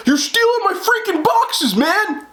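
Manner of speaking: slow, high-pitched mock shout; imitated yelling. Homeowner accusing delivery lady of stealing, imitated yell. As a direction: act out an outraged, accusing yell. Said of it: "I'm sorry I'm yelling".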